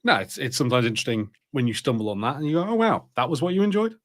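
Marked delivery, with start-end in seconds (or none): none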